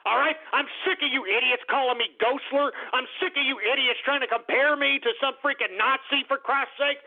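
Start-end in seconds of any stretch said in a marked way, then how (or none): none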